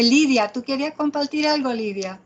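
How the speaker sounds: ordinary talk, nothing marked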